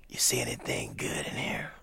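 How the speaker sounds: soft, breathless greeting; imitating Miles's whispered rasp; raspy whisper. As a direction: rasp it barely above air.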